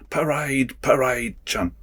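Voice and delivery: high, drawn-out parade-ground call; drill command. Retold or imitated. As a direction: call the squad to attention, pitched high. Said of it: "the only word of command you get is".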